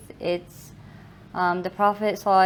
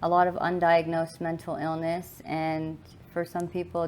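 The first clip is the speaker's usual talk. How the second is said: ordinary talk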